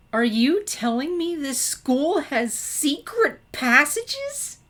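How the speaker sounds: high, slow, thrilled disbelief; wide-eyed Twilight grabbing Sunset, thrilled; excited. Acted. Twilight asks Sunset about secret passages, high and excited.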